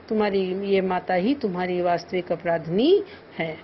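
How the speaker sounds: ordinary delivery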